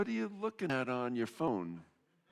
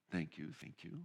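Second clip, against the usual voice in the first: soft thanks; 5 semitones lower, 11 dB quieter than usual. Real, not acted. Quiet thanks to volunteers.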